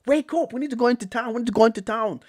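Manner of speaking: high, urgent pleading to wake up; playful high-pitched nagging; mock wake-up call.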